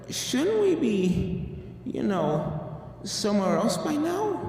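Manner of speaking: drawn out in confusion; puzzled. Acted. Applejack asks her friends, slow and puzzled.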